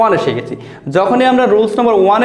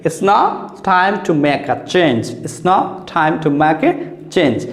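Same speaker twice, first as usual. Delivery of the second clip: slow demo; much slower than usual. Shown as a demonstration. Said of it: "একটা ঠ আমরা কেটে দিলাম".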